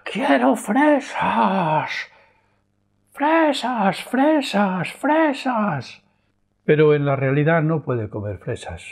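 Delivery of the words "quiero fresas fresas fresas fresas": very high, very slow, louder mock scream of a child; hammed-up child on the floor screaming; screaming tantrum. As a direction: drag out each fresas in a wailing shriek.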